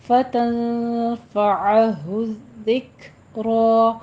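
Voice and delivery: ज़िक्रा with a breathy काफ and a heavy रा; tajweed demo. Shown as a demonstration. Teacher shows the heavy ra, slow. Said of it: "काफ के ऊपर हम्स करेंगे और रॉ को पुर पढ़ेंगे".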